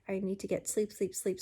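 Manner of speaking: ordinary delivery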